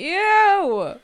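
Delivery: high, loud, drawn-out disgusted squeal; real disgust. Real, not acted. Reacting to co-host's gross cap joke, disgusted. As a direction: recoil with a long rising ew.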